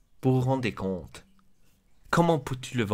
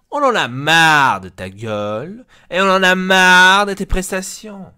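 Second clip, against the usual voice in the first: loud, raised-pitch angry rant; fed-up outburst; 6 semitones higher, 8 dB louder than usual. Acted. Club boss telling off a player, fed-up outburst. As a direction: blow up at an underperforming player.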